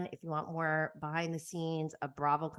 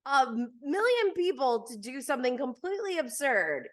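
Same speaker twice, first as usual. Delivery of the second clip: baffled wonder; 6 semitones higher, 3 dB louder than usual. Retold or imitated. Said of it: "you're like".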